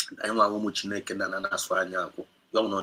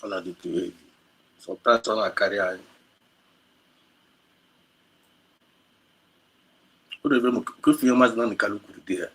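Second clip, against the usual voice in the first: emotional, near tears. Real, not acted. Speaker overwhelmed by the message, voice breaking and fading. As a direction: struggle to hold back tears.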